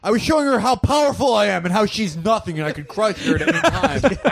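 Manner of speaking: high, laughing villain boast; laughing mock villain. Parodying the hero's bragging, high laughing villain. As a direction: brag like a cartoon villain through laughs.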